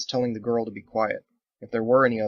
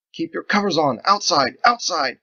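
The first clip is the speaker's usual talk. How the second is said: higher, louder barked commands; shouted orders; 7 semitones higher, 7 dB louder than usual. Acted. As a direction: herd them out fast. Andolin keeps shouting orders.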